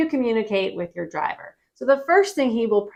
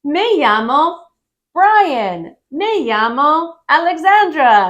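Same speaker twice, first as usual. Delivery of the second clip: higher and louder, bright "me llamo Brian"; cheerful introduction; 6 semitones higher, 7 dB louder, slower than usual. Shown as a demonstration. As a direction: introduce yourself with a big happy voice. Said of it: "you should respond and say".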